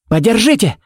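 high, sudden barked command; urgent order, pitched well up. A narrator's reading. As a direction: bark it out for immediate help. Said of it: "рявкнул он".

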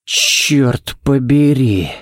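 slow curse breathed out on the exhale; exhaled curse. A narrator's reading. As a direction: let the curse go with your breath. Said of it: "выдохнул Балок". (выдох: «Чёрт побери!»)

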